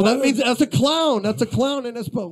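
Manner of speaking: high, loud, eager telling; a lively retold explanation of clown; excited explanation. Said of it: "I go".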